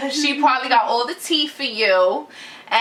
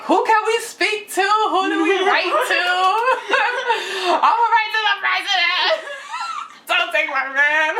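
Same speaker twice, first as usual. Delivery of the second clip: high, laughing through frantic pleas; hammed-up panic, cracking up; laughing mock panic; 7 semitones higher than usual. Retold frantic pleading, laughing through.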